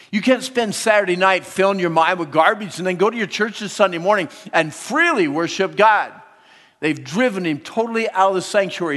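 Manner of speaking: fervent sermon warning; preaching. Real, not acted. Preaching at full force.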